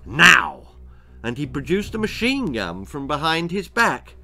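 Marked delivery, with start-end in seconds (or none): 0.0-0.8 louder sharp command; barked order. A narrator's reading. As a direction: bark it at him. Gunman orders Daffyd.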